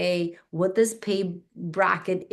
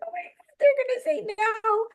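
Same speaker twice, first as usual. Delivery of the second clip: very high, tiny panicked voice; mock panic, far higher pitch; panicked squeak. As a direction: squeak out the dread. Panic on the phone.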